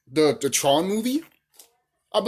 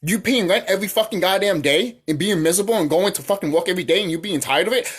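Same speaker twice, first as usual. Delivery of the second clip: ordinary talk, nothing marked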